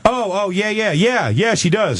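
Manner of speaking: lightly voiced eager man; eager audience guy. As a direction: blurt it out to please him. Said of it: "the guy goes".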